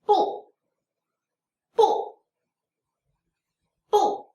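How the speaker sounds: "bu" starting high and dropping sharply; fourth tone. Shown as a demonstration. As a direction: punch "bu" short, loud and falling. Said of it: "is the fourth tone in Mandarin".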